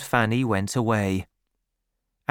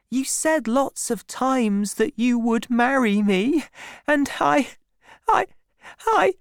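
put-on high, pleading girl, faltering at the end; tearful high young woman's voice; near tears; 12 semitones higher than usual. A narrator's reading. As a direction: plead for marriage, breaking into stammers. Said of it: "Don't cry, Fanny".

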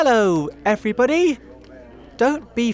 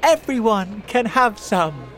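put-on loud, high boy's call; generous, high-pitched shout; shouted invitation; 10 semitones higher, 7 dB louder than usual. A narrator's reading. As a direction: invite the whole crowd. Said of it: "shouted James".

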